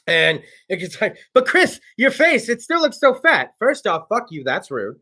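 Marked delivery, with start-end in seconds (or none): 1.3-3.5 high-pitched whiny-critic imitation; mock critic. Imitating a viewer commenting on his face, high mocking complaint. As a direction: mimic a nitpicking fan, pitched up.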